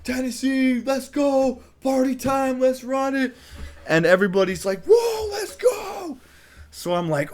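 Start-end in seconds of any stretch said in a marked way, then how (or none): 0.0-3.4 high, loud rallying yell; rally the room like a cheerleader; hyped shout. Retold or imitated. Narrator rallying the cheering crowd, high hyped shout. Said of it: "Everybody's freaking out". 4.9-6.2 very high, slow whooping shout; crowd whoop. Retold or imitated. Everybody answering the hype speech with a high whoop.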